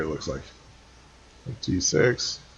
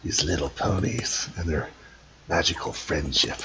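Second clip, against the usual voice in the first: low sleazy little henchman voice; spy voice; 6 semitones lower, 7 dB quieter than usual. Acted. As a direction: lower and scheming, like a sidekick villain. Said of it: "You're doing the voice of the little guy".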